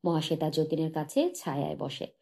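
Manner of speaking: ordinary delivery, nothing marked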